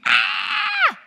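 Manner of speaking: sudden high shrieking scream, retelling the nurse; a startled nurse's shriek, replayed full out; retold scream. Nurse shrieks on finding the dead wife alive, piercing scream. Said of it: "she screams".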